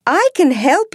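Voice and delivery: very high, squeaky mouse voice; tiny mouse offering help; eager little mouse. Acted. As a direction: pipe it up high and keen. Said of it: "says the mouse".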